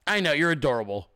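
ordinary talk, nothing marked

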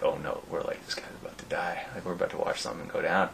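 very soft, near-whispered onlooker dread; acted-out whisper among bystanders; retold hushed aside. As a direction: murmur it to friends as trouble brews. Onlookers' retold aside before a fight.

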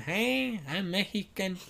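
hammed-up Mexican accent voice. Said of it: "Like Mexicans".

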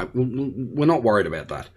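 ordinary delivery

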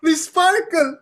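high, loud mock-Italian accent; hammed-up Italian accent echo; Paolo. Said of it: "the way he spoke was very".

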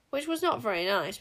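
usual speaking voice

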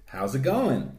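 casual American texter; put-on American accent. Chatting as a number neighbour, American accent. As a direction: ask it as an easygoing American. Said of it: "all of these Twitter exchanges are American".

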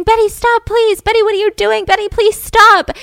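high, loud acted scream; screaming plea. Candy struggling for the axe with Betty, screaming. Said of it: "she's, she's screaming".

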